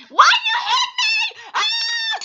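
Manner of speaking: high, loud, slow squeaky yelp; one last shrill squeaky outburst; squeaky voice shout. Acted. Gamer girl yelling at an attacker in the squeaky voice. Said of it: "I'm gonna do it one more time".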